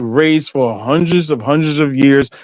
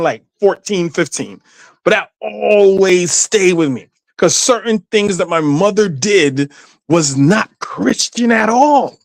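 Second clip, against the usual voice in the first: ordinary talk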